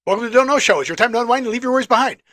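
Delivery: rattled off fast, a bit loud. Shown as a demonstration. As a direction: rush the intro at high speed.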